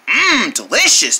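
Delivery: ordinary talk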